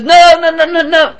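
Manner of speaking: put-on frightened girls begging the mother; panicked pleading. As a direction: beg her to stop.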